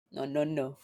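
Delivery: ordinary talk, nothing marked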